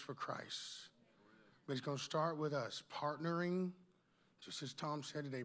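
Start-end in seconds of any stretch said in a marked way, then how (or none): none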